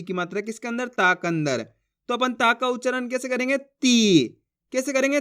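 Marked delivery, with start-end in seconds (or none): 3.6-4.3 ती with a long, held ee; drawn-out vowel. Shown as a demonstration. Teacher models ता plus बड़ी ई, ती drawn out long. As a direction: stretch the ई in ती long. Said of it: "जिस तरीके से मैं खींच खींच के पढ़ रहा हूँ".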